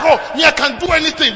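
mocking boast. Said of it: "and it's like".

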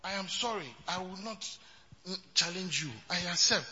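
soft, humble giving in; meek apology. Pretend. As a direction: back down gently, no fight. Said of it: "So, meti, easily".

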